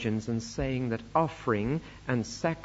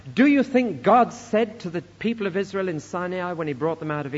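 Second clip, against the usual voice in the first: loud, raised rhetorical declamation; forceful preaching; 5 semitones higher, 6 dB louder than usual. Acted. Preacher mockingly voices God's supposed demand, forceful preaching. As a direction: preach it out, punching key phrases high and loud.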